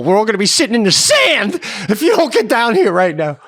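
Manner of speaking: high, loud, urgent mock yell; frantic ordering. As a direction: imitate a father rousing the family. Imagined father ordering family down.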